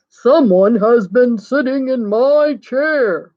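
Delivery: slow, booming Papa Bear; big bear voice, drawn out slowly; great big voice. A narrator's reading. Papa Bear finds his chair was used, great big voice. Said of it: "in his great big voice".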